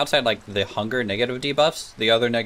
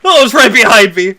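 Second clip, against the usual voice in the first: fast panicked shouting; being chased; 12 dB louder than usual. Real, not acted. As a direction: yell it while fleeing in terror. Running from bear.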